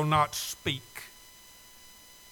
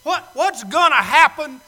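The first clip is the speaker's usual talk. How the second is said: loud, higher pleading to the Lord; loud plea to God; 4 semitones higher, 10 dB louder than usual. Acted. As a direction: cry out to God as the one on trial. Sinner pleading with God at judgment.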